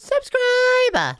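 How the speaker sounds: high, drawn-out excited call; playful cheer. Real, not acted. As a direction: sing out the sign-off plug. Echoes the sign-off call.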